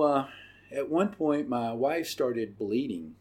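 ordinary talk, nothing marked

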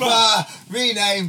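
rally the room to rename; loud call. Real, not acted. Bandmates chanting for the dog to be renamed, loud.

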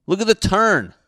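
loud, high burst at the screen; excited shout. Real, not acted. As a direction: yell it out in disbelief. Reacting loudly to the video.

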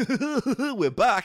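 laughing wacky DJ intro. Acted. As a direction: bounce in with a goofy laugh. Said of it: "did that dumb laugh".

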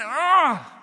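loud, high-pitched anguished "ah"; comic wail of embarrassment; embarrassed cry. Retold or imitated. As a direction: cry out in mock agony. Imitates moment of embarrassment.

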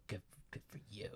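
hushed, barely voiced congratulation; demonstrated soft delivery. Shown as a demonstration. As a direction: demonstrate it very softly, almost whispered. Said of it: "you say it like".

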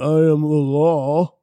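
slow, heavy tough-guy line; action movie hero. Pretend. Stallone talk, movie line.

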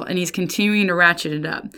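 ordinary talk, nothing marked

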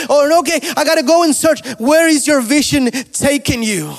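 high, loud, driving sermon cry; impassioned pulpit shouting; full-force preaching. Acted.

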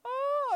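loud high dry-sob wail; play-acted crying howl; mock dry crying. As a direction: burst into a hammed-up tearless wail. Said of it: "now you're dry crying or drying".

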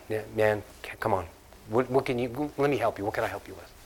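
soft, gentle offer of help; a kind stranger softly offering help; kind helper. Pretend. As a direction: quietly reassure a stranger. Voicing kind helper in store.

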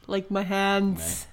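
slow, lightly voiced Trump; Trump impression. Host mocks Trump's hands, slow mock Trump voice. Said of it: "Is that how he talks?".

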